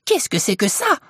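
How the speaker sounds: high, indignant wife's outburst; incensed high-pitched question; wrathful cry. A narrator's reading. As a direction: demand it in outrage, voice pitched high. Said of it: "elle cria d'un ton courroucé".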